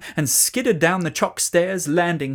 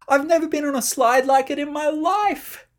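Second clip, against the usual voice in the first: much higher thrilled call; delighted; 12 semitones higher, 3 dB louder than usual. A narrator's reading. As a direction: shout with joy. Delighted slide ride.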